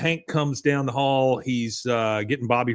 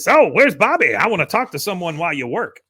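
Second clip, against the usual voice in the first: put-on higher, curt old man; mean old man; 5 semitones higher, 5 dB louder than usual. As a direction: snap it like a mean grandpa. Cotton voiced, mean.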